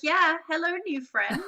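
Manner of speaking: loud, high, delighted hello; eager greeting. Retold or imitated. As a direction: greet new friends with gusto. Her eager reply to strangers.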